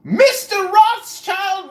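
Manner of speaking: slow hammed-up villain rant; mock villain shout. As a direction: drag it out like a cartoon villain.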